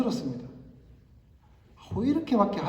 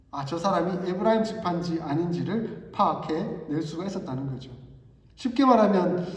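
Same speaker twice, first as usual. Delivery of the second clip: ordinary talk, nothing marked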